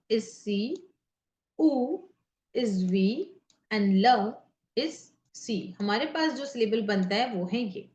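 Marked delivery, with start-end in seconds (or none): 1.6-1.7 उ as a lone vowel; अलग ध्वनि. Shown as a demonstration. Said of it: "syllable निकालते हैं". 4.0-4.3 ल as a separate, loud sound; अलग ध्वनि. Shown as a demonstration. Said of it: "syllable निकालते हैं".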